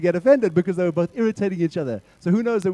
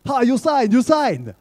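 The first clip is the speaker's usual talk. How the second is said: put-on Chinese accent; 6 semitones higher, 5 dB louder, slower than usual. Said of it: "Chinese guy returns".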